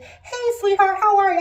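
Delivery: very high, loud, overdone greeting; exaggerated high-pitched sweetheart greeting; imitated suspicious sweetness. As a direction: mimic a radiologist's ominously sugary hello. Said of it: "Why are you saying it like that?".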